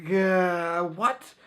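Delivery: a startled yelp of disbelief; shocked double take. Retold or imitated. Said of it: "I'm like".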